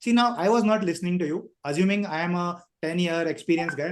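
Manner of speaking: normal talking voice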